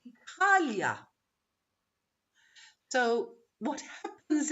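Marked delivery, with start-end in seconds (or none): 0.1-1.0 "Italia" with the stress on "TAL"; Turkish pronunciation. Shown as a demonstration. Teacher showing the Turkish version, middle stressed. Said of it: "Because in Turkish".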